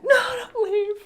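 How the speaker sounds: put-on high pleading little-girl voice; whiny child plea. Mom imitating daughter begging her not to leave. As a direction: mimic a clingy toddler begging.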